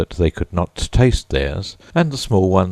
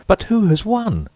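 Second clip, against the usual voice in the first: high, lightly voiced breathless creatures asking; mock-panting eager query; panting question. A narrator's reading. Crowd of creatures asking the Dodo, lightly panting. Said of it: "panting, and asking".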